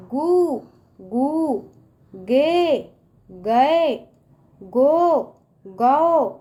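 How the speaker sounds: syllable by syllable, each one separate and stretched; slow practice recital. Shown as a demonstration. Teacher showing children how to read the row, slow recital. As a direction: recite the row slowly, a pause after each. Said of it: "हम किस तरह से पढ़ेंगे".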